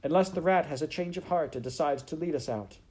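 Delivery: shouted. A narrator's reading. Princess to maid about the rat relenting, quick shout. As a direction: keep calling it out briskly. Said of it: "shouted the princess".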